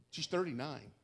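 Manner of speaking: normal talking voice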